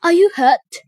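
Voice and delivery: high, loud concern; anxious begging. A narrator's reading. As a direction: beg to know, worried. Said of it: "she begged Sebastian".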